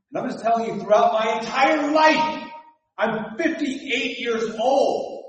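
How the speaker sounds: loud and slow; emphatic preaching. Real, not acted. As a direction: drive it home to the church.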